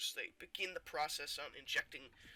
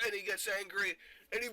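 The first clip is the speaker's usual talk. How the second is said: worked up; 4 semitones higher, 5 dB louder, faster than usual. Acted. Narrates rage in worked-up voice.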